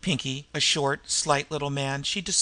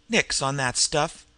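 ordinary delivery, nothing marked

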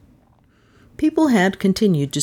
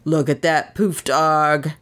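Cockney yob; slower than usual. A narrator's reading. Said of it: "an East Enders coached voice".

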